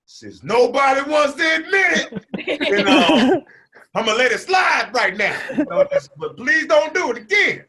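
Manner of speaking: high, loud, laughing bluster; mock tough shout. New kid bluffing at the class bully, laughing shout. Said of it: "And then I was like".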